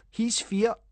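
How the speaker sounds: high jeering taunt; sniggering pal teasing a scared friend; mocking. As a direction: sneer it up high at him. Liam mocking Callum.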